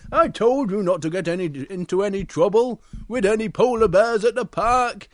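loud, emphatic scolding bear; Big Bear voice. Acted. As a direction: tell the cub off firmly. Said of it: "and Big Bear comes over".

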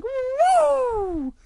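very high, loud, drawn-out woo; sliding whoop. Acted. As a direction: whoop all the way down a slide. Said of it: "And he climbs up the stairs again".

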